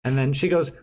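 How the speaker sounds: usual speaking voice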